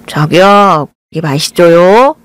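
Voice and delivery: put-on cutesy pet-name voice; playful cute. A narrator's reading. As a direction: tease your fiancée playfully. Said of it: "장난스럽게 말을 걸었습니다".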